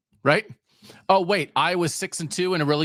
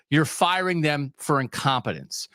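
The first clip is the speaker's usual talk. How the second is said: ordinary delivery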